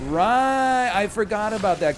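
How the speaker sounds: loud, high, drawn-out exclamation; mock realization. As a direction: exaggerate suddenly remembering. Mock sudden remembering of economics.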